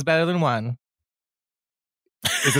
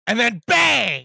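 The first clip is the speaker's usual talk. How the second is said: slow, loud, higher shout of bang; and then bang, shouted slowly; dramatic bang; 7 semitones higher, 3 dB louder, slower than usual. Retold or imitated. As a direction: boom it out like a movie trailer. Narrating the explosion.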